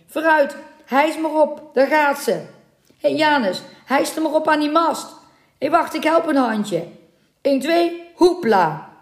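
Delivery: high, lively sailor's shout; rally the crew to haul away; hearty heave-ho call; calling out orders. Acted. Sailors hoisting mermaid, calling out.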